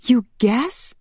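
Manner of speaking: high, incredulous woman's voice; indignant cry. A narrator's reading. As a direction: cry it out in disbelief. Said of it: "Shanna cried".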